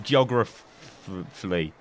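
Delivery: "geographically" slowly botched as "geo-gruh-f-flee"; slow down and still stick on the f of "geographically"; stumbling pronunciation. Real, not acted. Retrying the word "geographically", slow stumbling pronunciation. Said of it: "That's what I'm trying to say".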